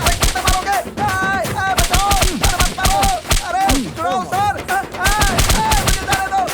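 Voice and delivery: yelping for help under blows; cries of pain. Acted. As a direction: howl and beg them to stop. Cries out while being beaten.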